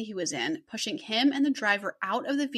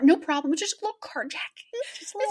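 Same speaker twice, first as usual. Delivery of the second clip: mock breezy; 7 semitones higher than usual. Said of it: "you'd be like".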